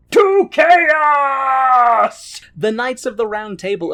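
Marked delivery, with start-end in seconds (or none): 0.1-2.4 slow, loud booming announcement; dramatic shout. Shown as a demonstration. Telling his wife the scene turns chaotic, booming slow shout. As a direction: boom it out like a trailer voice. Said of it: "dramatic announcement of chaos".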